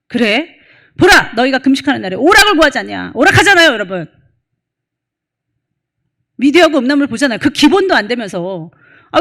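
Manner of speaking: loud, urgent sermon delivery; a pastor hammering home a warning from the pulpit; full-force preaching. Acted. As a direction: preach hard and press the congregation.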